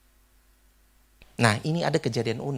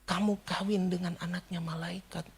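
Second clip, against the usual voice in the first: softer, mildly tearful retold voice; imitated tearful awe; 8 dB quieter than usual. Old man in tears to the groom, soft imitated awe. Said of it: "dia sambil menangis".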